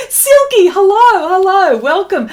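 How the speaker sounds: high, loud sing-song hello; excited greeting. Real, not acted. Artist greeting a chat viewer, excited and high.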